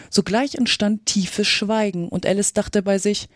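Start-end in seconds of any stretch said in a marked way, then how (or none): none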